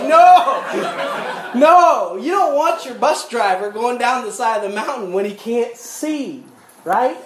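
high, loud, laughing "no, no"; laughing loud outcry. Retold or imitated. Passengers shouting at the bus driver, laughing and loud. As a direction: cry out with the whole bus, half laughing.

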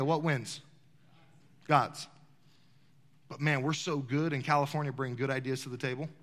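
ordinary delivery

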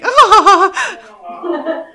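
loud, high, laughing "oh"; hammed-up gasp of delight; mock amazement. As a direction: imitate a flattered man's astonishment. Retold man amazed by flattery.

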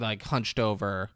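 ordinary talk, nothing marked

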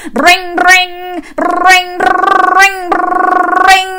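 loud, high rolled-r trills; ringing alarm clock. Pretend. Presenter getting children to be alarm clocks, loud ringing. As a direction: trill like an insistent bell. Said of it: "Can you be an alarm clock".

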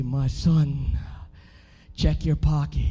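loud, very low God voice; mock voice of God. God speaks from the spotlight, deep booming mock voice. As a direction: boom it out deep, like God from above. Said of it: "And God to say".

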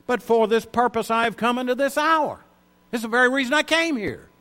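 high, fast, urgent preaching; impassioned preaching. Acted. Preacher voicing Jesus' resolve to the congregation, impassioned. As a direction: preach it out with rising fervour.

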